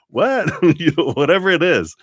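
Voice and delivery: high, loud laughing what; amused disbelief. Real, not acted. Reacting to her own clown school idea, high and laughing. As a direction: yelp in mock disbelief, laughing.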